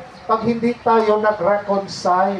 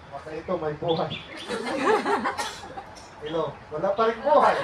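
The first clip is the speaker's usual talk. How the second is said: joking laugh; 8 dB quieter, much faster than usual. Real, not acted. Joking with a listener.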